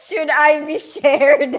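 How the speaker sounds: loud, slow question through laughter; real fit of laughter; laughing. Real, not acted. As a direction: barely get the question out for laughing. Cracks up reading chat message.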